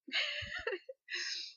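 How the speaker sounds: high teary half-sob laugh; choked teary laugh; real tearful laugh. Real, not acted. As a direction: laugh through the tears. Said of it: "I'll get it together".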